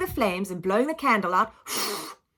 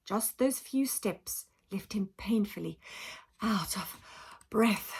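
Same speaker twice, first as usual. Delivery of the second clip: breathless hush; 8 dB quieter than usual. A narrator's reading. Alfred painfully out of breath.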